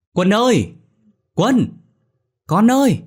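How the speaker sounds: tiếng gọi to, cao vút; gọi con lạc thật to; gọi lớn. A narrator's reading. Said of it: "Chị vừa đi vừa gọi lớn". (gọi lớn vừa đi vừa tìm)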